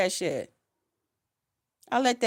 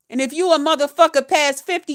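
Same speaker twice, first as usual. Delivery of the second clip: normal talking voice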